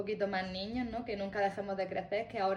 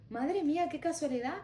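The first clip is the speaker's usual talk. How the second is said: amazed lady; 7 semitones higher than usual. Retold or imitated.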